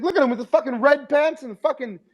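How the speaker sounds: mock the guy in the red pants; high hammed-up roast; Bill Burr roast.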